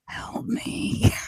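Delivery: forceful, hammed-up whisper; pleading whisper. Dennis in the corner begs to be saved, pleading whisper. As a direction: whisper a mock plea for rescue. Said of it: "Dennis in the corner is just kinda like".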